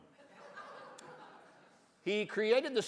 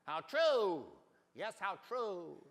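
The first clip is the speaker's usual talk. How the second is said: big kangaroo; 2 semitones higher, slower than usual. Acted. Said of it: "said the big kangaroo".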